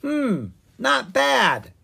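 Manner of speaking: very high, slow, savouring; squeaky newt tasting a bug; tiny newt voice. Acted.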